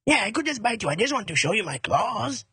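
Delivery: coaxing mock-French accent; hammed-up French-accented tiger. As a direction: insist innocently in a comic French accent. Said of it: "A French tiger".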